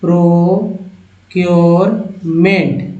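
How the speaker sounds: प्रोक्योरमेंट said very slowly, syllable by syllable; word drawn out slowly. Shown as a demonstration. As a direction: stretch प्रोक्योरमेंट out for learners. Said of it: "जैसे यहाँ देखिए".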